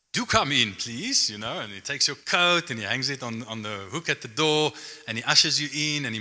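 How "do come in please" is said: lightly voiced British butler welcome; a touch of English butler politeness; British butler accent. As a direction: usher them in as an English butler. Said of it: "a butler with, you know, a British accent".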